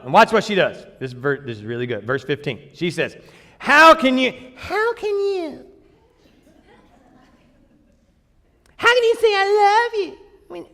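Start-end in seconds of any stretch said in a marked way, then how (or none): 3.6-10.7 high put-on woman's voice, hurt; girl voice. Delilah reproaching Samson for his love in a high girl voice. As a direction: plead it in a high girlish voice. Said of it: "I can't even do the girl voice for very long".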